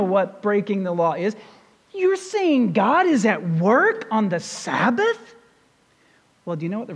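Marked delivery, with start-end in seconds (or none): 1.9-5.4 slow, disbelieving challenge rising at the end; incredulous question. Acted. Religious leaders challenging Jesus' claim, incredulous. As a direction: draw it out in disbelief.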